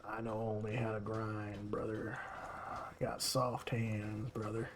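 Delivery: put-on quiet southern accent, slow on soft hands. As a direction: play Double as a southern grinder, murmured. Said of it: "Don't know why he has a southern accent".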